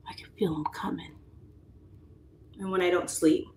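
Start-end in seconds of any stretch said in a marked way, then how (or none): 0.1-1.1 whisper. Retold or imitated. Dreading wrinkles.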